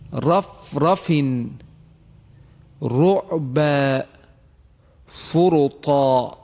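رفرف، رعب، فرطا with each ر thick and full, slow; heavy ra. Shown as a demonstration. Tajwid teacher reciting examples to students, heavy ر. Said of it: "به این مثال ها توجه کنید".

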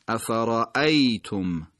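افرأیتم said slowly, with a sharp, separated hamza; crisp glottal stop in افرأیتم; slow, exact افرأیتم; sharp hamza demo. Shown as a demonstration. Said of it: "حتماً در ادایش و در خواندنش دقت".

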